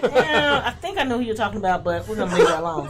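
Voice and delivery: high, loud, laughing as she plays it off; laughing through the quote. Retold or imitated. Comic to coworkers who saw her show, laughing. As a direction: giggle while brushing off the coworkers.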